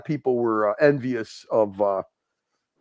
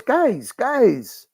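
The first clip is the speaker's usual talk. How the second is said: higher, lightly put-on Pakistani accent calling guys; imitating Saad; 8 semitones higher than usual. Retelling a streamer friend calling his chat, mock accented. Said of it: "he goes".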